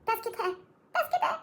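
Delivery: high, squeaky playful cry, climbing with each repeat; mock plea for help. Overwhelmed learner jokingly begs for help, mock-pleading. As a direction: mock-desperate plea, breaking into a laugh.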